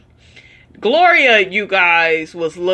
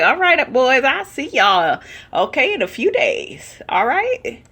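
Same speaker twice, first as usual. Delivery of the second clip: put-on much higher, sing-song farewell to the boys; breezy goodbye; 6 semitones higher than usual. Commentator pretending to be the mother happily letting the boys go, sing-song. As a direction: wave them off cheerfully, mock carefree. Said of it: "I'd be like".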